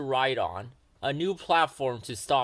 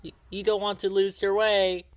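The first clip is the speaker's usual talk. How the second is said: raised, higher call after a leaving train; shouted warning; 6 semitones higher, 5 dB louder than usual. A narrator's reading. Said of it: "shouted after Thomas".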